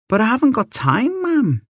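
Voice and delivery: put-on very high-pitched boyish complaint; a child objecting to his mam; pleading protest. A narrator's reading. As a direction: plead your case, pitch climbing high at the end.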